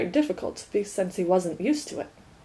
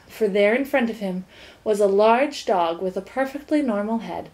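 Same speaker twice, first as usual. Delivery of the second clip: usual speaking voice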